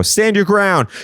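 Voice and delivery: high mock battle cry; gun owner's cry. Neighbour about to shoot the toy, high mock cry. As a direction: call it out high, as a trigger-happy neighbour would. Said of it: "waiting for someone to go".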